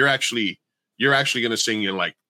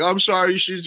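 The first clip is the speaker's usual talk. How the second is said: higher-pitched imitation of an apology; put-on apologetic voice, pitched up; apologetic friend; 8 semitones higher than usual. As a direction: plead lightly on a friend's behalf.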